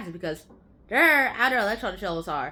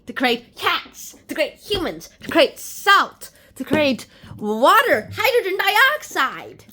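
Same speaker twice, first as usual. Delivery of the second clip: slow dramatic listing; 5 semitones higher, 8 dB louder, slower than usual. Acted. Listing creations with big emphasis.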